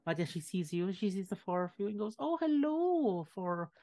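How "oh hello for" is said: lightly voiced sweet old lady, higher; kindly elderly woman. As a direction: greet the adventurers like a kindly granny.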